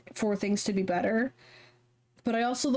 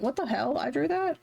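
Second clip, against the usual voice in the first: mock disbelief; 5 semitones higher than usual. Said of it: "And I went".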